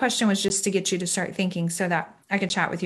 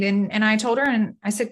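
ordinary talk

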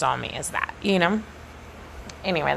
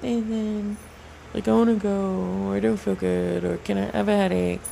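whiny mock plea. Mocking herself asking boss off.

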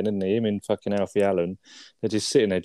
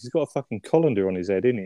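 ordinary talk

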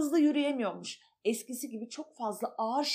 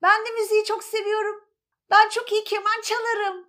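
higher, louder, fast eager cat; the cat chimes in eagerly; 9 semitones higher, 7 dB louder, much faster than usual. Acted. Cat voice joining. (tiz, hızlı ve hevesli kedi sesi)

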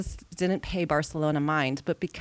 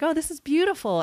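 high, fast mock gushing; a breezy sightseer's gush; mock tourist gush; 11 semitones higher, 3 dB louder, faster than usual. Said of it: "you're like".

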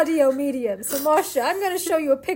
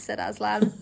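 ordinary delivery

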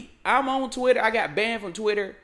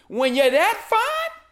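admiring swoon; 11 semitones higher, 4 dB louder, slower than usual. Real, not acted. Admiring the fine actress.

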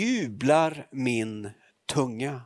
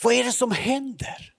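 called out much higher, like a crowd shouting; crowd calling out; 10 semitones higher than usual. Retold or imitated. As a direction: raise your pitch and cry out the question. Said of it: "man kan nästan höra hur de ropar".

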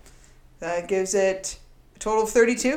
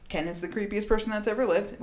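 ordinary delivery

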